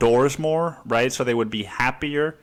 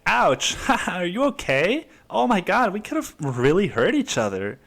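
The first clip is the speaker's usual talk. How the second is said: higher, laughing mock concern after a bike crash; overly polite cyclist; 7 semitones higher than usual. Cyclist crashes into another, gushing with mock sweetness. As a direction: gush with sugary politeness, laughing. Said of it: "I'm like".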